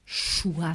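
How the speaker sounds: "Choua" opening on an sh-like Basque s; hushing S. Shown as a demonstration. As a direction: let the first sound of "Choua" hush like sh. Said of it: "Le S est chuente". (« Choua » : s chuinté au début)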